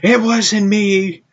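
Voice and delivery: high-pitched mock child voice; protesting little nephew. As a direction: imitate a small boy pleading innocence. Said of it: "My nephew goes".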